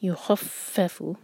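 युखफ़फ़ु with heavy खा and clear doubled फ़; slow, press the शद्दा on फ़. Shown as a demonstration. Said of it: "फा पर शद्दा clear".

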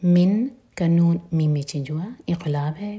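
ordinary delivery

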